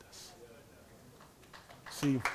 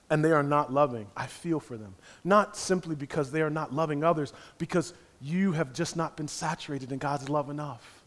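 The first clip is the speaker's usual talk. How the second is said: ordinary talk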